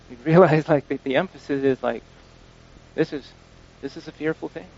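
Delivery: normal talking voice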